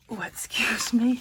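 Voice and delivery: hiccuping mid-sentence. Real, not acted. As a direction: cut off by a hiccup. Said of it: "I hiccup, don't I".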